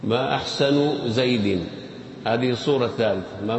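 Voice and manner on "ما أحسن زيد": ما أحسن زيد drawn out slowly as ma ahsanu Zaydin; slow careful demo. Shown as a demonstration. Teacher modelling the right vowels for the sheikh, slow. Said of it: "انطقها مرة أخرى".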